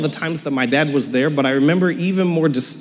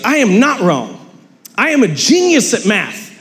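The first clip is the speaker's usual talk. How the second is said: angry dad; 6 semitones higher than usual. Retold or imitated. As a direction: insist you're right, a touch heated. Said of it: "he got mad".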